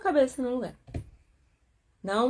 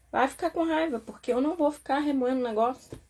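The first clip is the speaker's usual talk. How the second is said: ordinary talk, nothing marked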